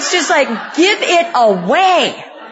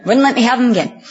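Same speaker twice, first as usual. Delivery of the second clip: normal talking voice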